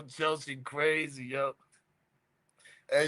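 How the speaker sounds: usual speaking voice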